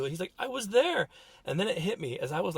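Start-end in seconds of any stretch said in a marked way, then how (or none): 0.4-1.1 high-pitched upset boy, imitated; retold tearful protest. Crying son insisting to his father, tearful protest. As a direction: insist in a raised child's pitch. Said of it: "he starts to cry".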